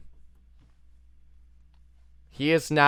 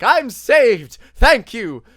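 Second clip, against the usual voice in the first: imagined thanks; 9 semitones higher, 11 dB louder, slower than usual. Retold or imitated. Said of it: "you think you're being like".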